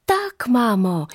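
put-on high, drawn-out happy child's cry; joyful calling. A narrator's reading. Children answer amazed mother, calling out joyfully. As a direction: call out joyfully, slow and high. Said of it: "zawołały radośnie dzieci".

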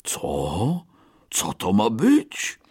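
startled. Acted. Bear woken, confused.

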